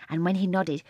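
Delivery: usual speaking voice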